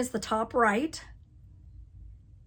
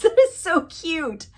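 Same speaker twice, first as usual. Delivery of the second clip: a giggle breaking into high, loud gushing; laughing delight; 6 semitones higher, 6 dB louder, slower than usual. Real, not acted. To viewers over a cute rabbit chart, laughing delight. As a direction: laugh it out, charmed by the bunny.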